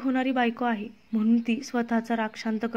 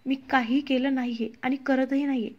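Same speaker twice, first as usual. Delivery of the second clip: plain reading voice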